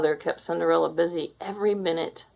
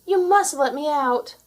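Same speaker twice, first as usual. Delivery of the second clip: higher, louder, slow plea; pleading; 8 semitones higher, 5 dB louder than usual. A narrator's reading. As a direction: beg to be let out. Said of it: "cried Cinderella".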